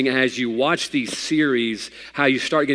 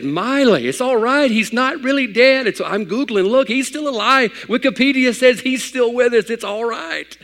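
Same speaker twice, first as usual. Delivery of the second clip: high, loud, insistent reassurance; comforting daughter; 11 semitones higher, 5 dB louder than usual. Retold or imitated. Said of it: "I said".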